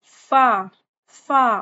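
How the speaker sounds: फा with lips spread into a slight smile; teacher's model फा, light and thin; correct fa. Shown as a demonstration. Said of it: "थोड़ी सी slight सी smile आएगी".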